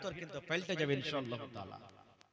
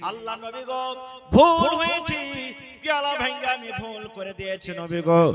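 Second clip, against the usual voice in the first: pleading; 11 dB louder than usual. Acted. Aisha begging the Prophet for forgiveness in a loud plea. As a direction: plead as if wailing out your fault.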